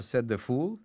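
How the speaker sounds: usual speaking voice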